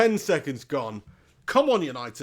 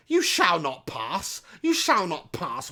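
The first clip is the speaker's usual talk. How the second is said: slow, mock-epic decree; wizard's command; 2 semitones higher, slower than usual. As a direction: intone it slowly like a wizard barring the way. Film wizard quote during match commentary.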